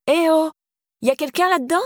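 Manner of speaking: high-pitched narrated shout, little extra volume; calling out. A narrator's reading. Girl drumming on a neighbour's door, calling out. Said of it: "cria la fille en tambourinant à la porte".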